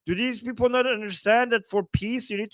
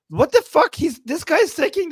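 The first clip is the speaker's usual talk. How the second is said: sudden higher, louder exclamation; outraged outburst; 6 semitones higher, 4 dB louder than usual. Real, not acted. Streamer reacts to the commentator defending the president, outraged. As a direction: blurt it out in real outrage.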